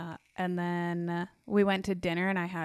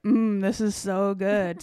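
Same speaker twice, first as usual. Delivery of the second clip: taunting; 5 dB louder, much slower than usual. Pretend. Taunting friend while eating dessert.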